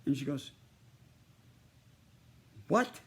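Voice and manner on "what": sharp high indignant What?, louder; an offended young woman's sudden outraged what. Retold or imitated. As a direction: snap back offended, high and loud. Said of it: "And she started getting angry".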